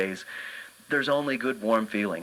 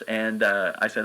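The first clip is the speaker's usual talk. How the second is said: usual speaking voice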